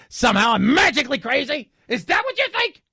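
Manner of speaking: mock outrage climbing very high; hammed-up crazed indignation; indignant conspiracy theorist. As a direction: build to a high, incredulous squawk. Acting Alex Jones's indignant rant.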